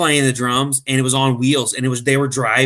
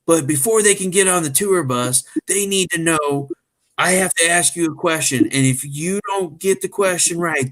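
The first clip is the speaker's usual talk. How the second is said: put-on rock star on the phone, pitched up; raised, official-sounding celebrity caller voice; 5 semitones higher than usual. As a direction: imitate a singer announcing himself, higher voice. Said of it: "you pretend you're [name]".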